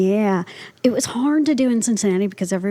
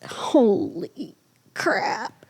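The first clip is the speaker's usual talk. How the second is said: slow stunned; much slower than usual. Retold or imitated. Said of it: "he's like".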